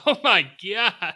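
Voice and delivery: loud, high laughing reaction; drawn-out, high exclamation through laughter; laughing disbelief. Real, not acted. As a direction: crack up while reacting in shock. Cracking up at harsh prediction.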